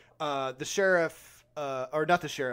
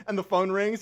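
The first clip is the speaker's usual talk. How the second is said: ordinary delivery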